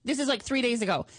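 ordinary talk

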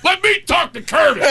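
angry father. Retold or imitated. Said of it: "I hear the father going".

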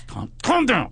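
high, squeezed hushing; ventriloquist voice. Retold or imitated. Comic hushing his friend at the stop, ventriloquist voice. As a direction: urge through nearly shut lips. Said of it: "that corner of your mouth ventriloquist".

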